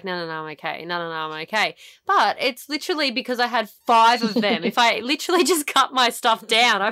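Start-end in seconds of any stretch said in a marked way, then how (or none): 0.0-1.8 low, much softer imitation of a polite no; quiet, low mock refusal of another chocolate. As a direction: decline gently, almost under your breath. Friend politely refusing more chocolate.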